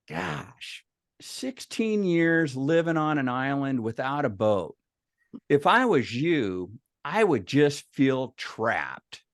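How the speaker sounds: slow, drawn-out sympathy; mock concern. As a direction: stretch it out to plant the worry. Brother plants the problem, acted.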